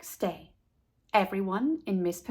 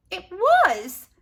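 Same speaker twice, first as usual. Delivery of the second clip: put-on high, loud dismayed girl voice; 10 semitones higher, 6 dB louder than usual. A narrator's reading. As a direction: ask it crestfallen and shocked. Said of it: "asked Leslie in dismay".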